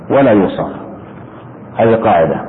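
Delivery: ordinary delivery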